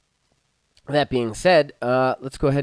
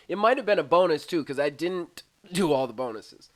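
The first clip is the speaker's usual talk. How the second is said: usual speaking voice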